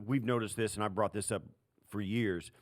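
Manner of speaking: ordinary talk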